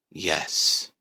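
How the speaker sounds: low breathy yes; creepy low whisper; sinister whisper. A narrator's reading. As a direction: hiss the answer softly. Dark voice answers the question.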